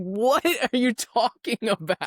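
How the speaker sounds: high, slow, baffled disbelief; mock outrage at a dumb line; incredulous. Mocking a dumb movie line, high and slow in disbelief. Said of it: "And I'm like".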